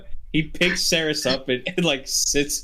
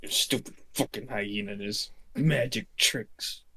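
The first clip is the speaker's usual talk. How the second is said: softer, lower sulky grumble; pouting mutter at the bar; grumpy mumbling; 4 semitones lower, 7 dB quieter than usual. Acted. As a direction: mumble the complaint under your breath. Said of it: "he's mumbling all of this".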